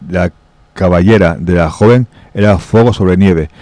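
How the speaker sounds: ordinary delivery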